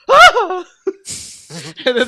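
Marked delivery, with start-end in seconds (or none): 0.1-0.6 very high, loud shriek of delight. Retold or imitated. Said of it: "he's like".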